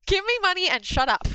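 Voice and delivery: mock blunt demand. Mock-demanding money from a booth customer, high and punchy. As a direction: bark it out as a joke. Repeats her joke line, amused.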